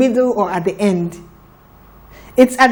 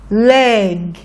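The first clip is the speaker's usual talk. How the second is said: faster blend; 2 semitones lower than usual. Shown as a demonstration. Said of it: "Faster now".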